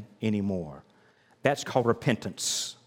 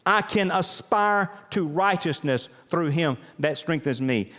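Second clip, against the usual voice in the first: rhythmic sermon litany; 6 semitones higher than usual. Acted. Pastor leading the congregation through the verse, full-force litany. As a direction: preach it as a rising chant. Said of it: "But say it like this".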